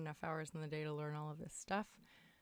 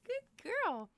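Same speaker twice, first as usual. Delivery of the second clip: pet praise; 7 dB louder than usual. Real, not acted. Praising her cat.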